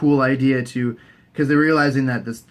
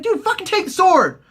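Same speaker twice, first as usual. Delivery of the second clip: high, shrill-pitched pleading at the screen; much higher mock frustration; exasperated urging. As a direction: beg him to just take it. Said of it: "I'm like".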